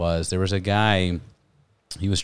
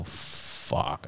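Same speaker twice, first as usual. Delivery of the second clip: whispered curse; 5 semitones lower, 12 dB quieter than usual. Retold or imitated. Reaction to blanket request.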